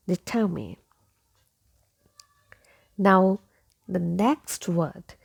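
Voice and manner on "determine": "determine" with short "min" ending, silent final E; correct determine. Shown as a demonstration. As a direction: softly model "determine" as dih-TUH-min. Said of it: "letter E is silent".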